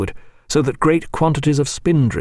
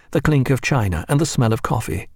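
plain reading voice

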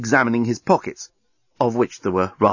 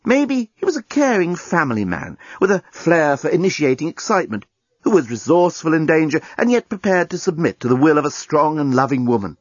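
plain reading voice

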